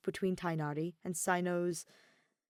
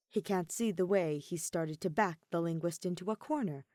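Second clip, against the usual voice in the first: plain reading voice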